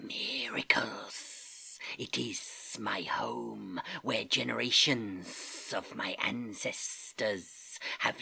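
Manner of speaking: slow, breathy snake whisper; hissing snake. Acted. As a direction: hiss it slowly into a sleeper's ear. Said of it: "hissed in his ear".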